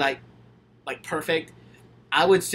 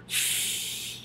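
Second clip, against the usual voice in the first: torn sigh; 11 dB quieter than usual. Real, not acted. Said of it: "That's tough".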